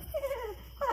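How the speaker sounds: put-on high-pitched little kid giggle; short high mock laugh; imitated children's laughter. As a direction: mimic small children laughing in the distance. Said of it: "I hear these little kids laughing".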